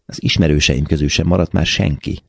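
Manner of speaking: ordinary delivery, nothing marked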